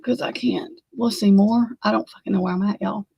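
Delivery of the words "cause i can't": breathy whisper, trailing off; whispered give-up. Real, not acted. Whispering to her viewers that she's stuck. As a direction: whisper it like you've given up. Frustrated, can't find the data.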